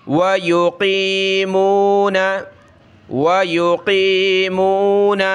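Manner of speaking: ویقیمون slowly joined, میم flowing into the واو; teacher drills ویقیمون; slow syllables. Shown as a demonstration. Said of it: "پیش پڑھیں گے".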